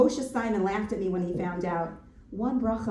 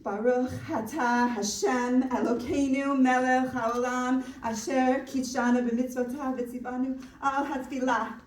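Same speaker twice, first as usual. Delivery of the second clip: loud, raised-pitch Hebrew blessing; recited blessing; 5 semitones higher, 4 dB louder than usual. Acted.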